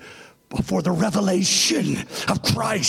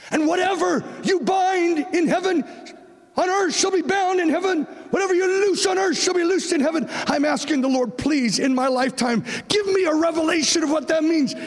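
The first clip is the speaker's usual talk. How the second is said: full-force preaching; 10 semitones higher than usual. Acted.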